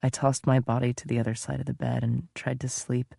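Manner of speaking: plain reading voice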